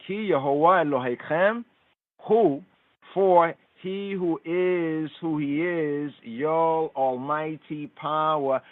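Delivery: normal talking voice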